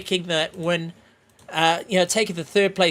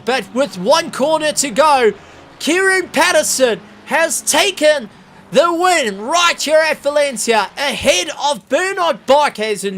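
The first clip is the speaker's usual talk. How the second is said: high, loud play-by-play of a frantic finish; excited race call; 6 semitones higher, 5 dB louder than usual. Real, not acted. Commentator calling the finish to viewers, excited. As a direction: call the last corner at full pitch.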